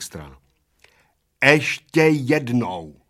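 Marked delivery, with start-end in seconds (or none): 1.4-3.0 very slow, every syllable hacked apart; waitress spelling out her last warning, slow and chopped; chopped letter by letter. Acted. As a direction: chop each sound like a meat cleaver. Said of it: "odsekávala číšnice písmenka jako sekáčkem".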